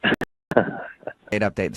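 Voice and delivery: breathy stage whisper of the segment name; a secretive aside, fully whispered. Pretend. Host teasing the segment name to the caller, in a stage whisper.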